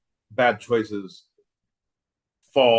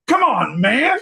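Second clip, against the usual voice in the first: high, loud exclamation; loud, high-pitched protest to a buddy; excited urging; 13 semitones higher, 6 dB louder, slower than usual. Pretend. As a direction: urge him on, voice jumping up. Excited heckle in plane story.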